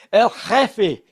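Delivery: El Jefe with a hammed-up Mexican accent, J as a breathy h, final e clear. Shown as a demonstration. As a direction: say El Jefe like a proud Mexican waiter. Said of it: "my best, eh, eh, Mexican accent".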